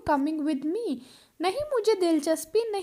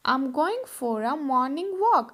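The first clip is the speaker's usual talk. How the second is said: slow model sentence; much slower than usual. Shown as a demonstration. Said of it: "मैं morning walk पर जा रही हूँ।".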